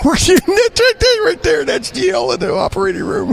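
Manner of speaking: high squeaky voice breaking into laughs; laughing silly voice. Pretend. Host teasing co-host in silly voice, laughing. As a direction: giggle through a mock squeak. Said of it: "do my voice for you".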